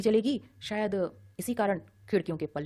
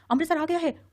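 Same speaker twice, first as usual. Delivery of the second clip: shouting; 9 semitones higher, 6 dB louder than usual. A narrator's reading. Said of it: "उसने फिर से कहा".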